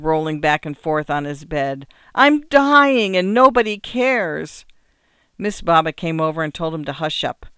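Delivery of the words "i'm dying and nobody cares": high, loud, drawn-out lament; loud dying act, slowing into self-pity; melodramatic wail. A narrator's reading. Boy melodramatically wailing on bed.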